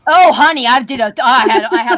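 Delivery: high, loud Oh honey, gleefully; gleeful and loud, relishing the tease; excited. Real, not acted. Bragging to friends about teasing her brother, gleeful and loud.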